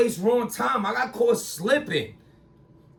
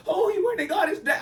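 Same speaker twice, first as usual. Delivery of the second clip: mocking gossip; 11 semitones higher, faster than usual. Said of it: "trying to turn that around and be like".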